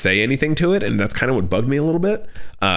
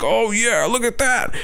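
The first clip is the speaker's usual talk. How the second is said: higher, delighted mock gasp at the sushi; excited old man; 10 semitones higher, slower than usual. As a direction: play the thrilled old guy. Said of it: "he's all excited".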